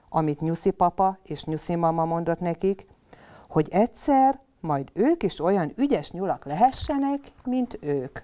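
ordinary delivery

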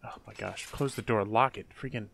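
usual speaking voice

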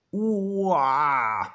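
high, drawn-out "uah" roar; long falling playful roar; mock crocodile roar. A narrator's reading. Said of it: "macht Toni".

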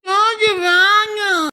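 high, long-drawn wail; crying whine. Acted. Small child refusing rice at mealtime, crying whine. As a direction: whine it out slowly through tears.

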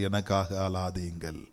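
low, hushed voicing of Jesus; soft quoted words. Shown as a demonstration. Jesus to the daughters of Jerusalem, hushed and tender. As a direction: say it gently, almost to yourself. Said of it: "ஒரு கரங்களை தட்டுவோமா?".

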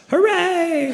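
high squeaky hooray; a hammed-up party cheer; mock cheer. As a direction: cheer it like a kid.

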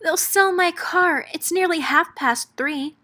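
wailing. Acted. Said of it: "Miss Spider wailed".

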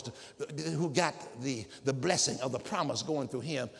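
ordinary delivery

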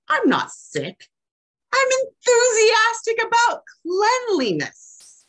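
very high, strained defensive voice on the denials; clean-freak persona. Acted. Speaker voicing an obsessive cleaner in a poem for Sherry, high and defensive. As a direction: play an indignant neat freak insisting she's fine.

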